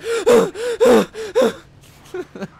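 big high burst of laughter; loud laugh. Real, not acted. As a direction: crack up laughing. Laughing at own story.

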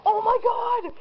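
high-pitched, breathless freak-out of delight; real excitement. Real, not acted. Gift opener thrilled by an unexpected plush spaniel, excited. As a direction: gush in a high voice, overwhelmed by the surprise. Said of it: "I am so excited".

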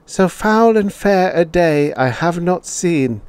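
put-on high chorus of children reciting; childish voices. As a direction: recite it like a class of small kids. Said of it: "The childish voices lifted".

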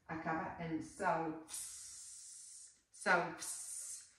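selves said slowly with a clear V; pronouncing selves. Shown as a demonstration. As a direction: stretch out selves so the v is heard. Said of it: "se pronuncia selves".

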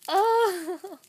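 sudden high cry of surprise; surprised. Real, not acted. Discovering the charm of her husband, a high surprised cry. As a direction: burst out in shock at what you see.

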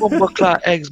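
imitating the video's Jamaican line; Jamaican accent. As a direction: toss off the clip's catchphrase in a Jamaican lilt. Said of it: "That was hilarious".

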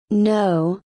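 ordinary delivery

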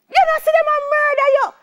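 loud, very high-pitched protest; alarmed yelling. Retold or imitated. Teacher warning the boy being beaten, alarmed high yell.

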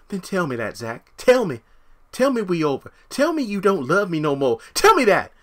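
high, loud demanding Karen voice; pleading Karen. Retold or imitated. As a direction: dare him to say it, desperate. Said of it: "Karen impression".

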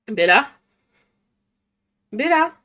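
calling out to a pet; sing-song call for the cat. Real, not acted.

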